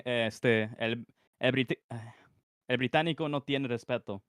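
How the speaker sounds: native Spanish pronunciation; Spanish accent. Shown as a demonstration. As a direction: read it with a fluent Spanish accent. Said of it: "I'll try saying it in ... my fluent, like, Spanish accent".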